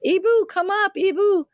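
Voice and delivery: high calling yell up the stairs; retold yelling. Daughter-in-law calls mother-in-law upstairs, high yelling. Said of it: "My daughter-in-law starts yelling".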